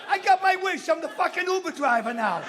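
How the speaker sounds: high, loud punchline outburst; exasperated shout. Acted. Comic stuck driving his own driver home, exasperated shouting. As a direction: yell it in comic indignation.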